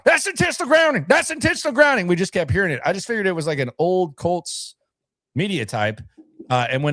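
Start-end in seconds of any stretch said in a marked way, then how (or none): 0.1-2.1 much higher, mocking a griping country voice; country twang complaining. Host imitating a grumbling country-twanged fan in the press box. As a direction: whine it like the old press-box grump. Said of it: "this one guy with a country twang that kept bitching".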